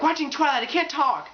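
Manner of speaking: high-pitched fangirl brush-off; mock dismissive girlfriend. Acting girlfriend brushing off boyfriend.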